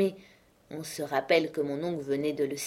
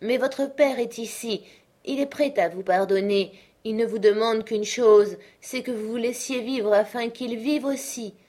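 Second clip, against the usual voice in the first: higher, louder urging doctor; pleading uncle; 6 semitones higher, 4 dB louder than usual. A narrator's reading. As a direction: beg her to live.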